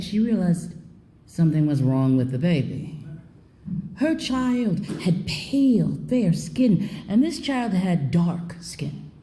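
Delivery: ordinary delivery, nothing marked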